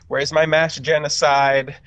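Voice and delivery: ordinary talk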